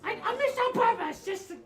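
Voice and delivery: very high, loud goblin squeal; pleading goblin. Acted. Goblin excusing his shot to intruder, squealed plea.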